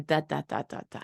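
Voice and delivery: "da, da, da" repeated syllable; reduplication. Retold or imitated. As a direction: repeat one syllable like a toddler. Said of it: "they're going".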